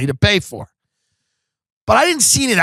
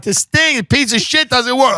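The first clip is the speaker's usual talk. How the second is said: high-pitched acted complaint; mock exasperated whine; irate customer; 8 semitones higher than usual. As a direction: play a fed-up customer griping on the phone. Acts out angry customer complaint.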